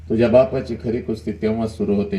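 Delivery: usual speaking voice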